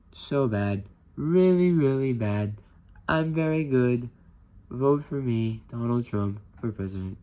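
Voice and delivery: hammed-up campaign boasting, drawn out; Trump impression. Teacher imitating Trump's campaign pitch, loud hammed-up bluster. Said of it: "Now I'm gonna do a Trump impression".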